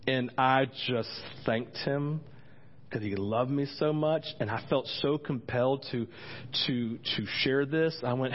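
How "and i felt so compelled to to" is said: normal talking voice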